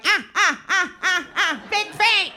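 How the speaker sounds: high, loud taunting "ah, big feet"; mocking jeer. Man behind her on the subway, high mocking taunt. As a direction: taunt her like a jeering stranger.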